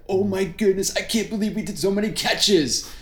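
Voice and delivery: high, giddy squeal of disbelief, imitated; giddy excitement. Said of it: "We were giddy with excitement".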